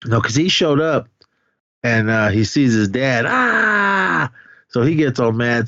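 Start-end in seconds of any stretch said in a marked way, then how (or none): 3.3-4.3 very high, long held "ah"; mock anguished scream. Retelling Gunther seeing his dead dad, mock anguished scream.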